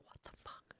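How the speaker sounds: incredulous whispered curse; whispered swear. Retold or imitated. Accepting the Montana move, a shocked whisper. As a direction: breathe it out in disbelief.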